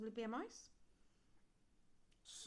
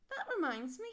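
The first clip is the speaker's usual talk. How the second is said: big skeleton voice; 5 semitones higher, 7 dB louder than usual. A narrator's reading. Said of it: "he said".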